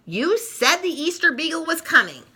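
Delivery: put-on furious girl confronting Linus; fuming. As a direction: demand an answer, outraged. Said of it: "Sally fumes".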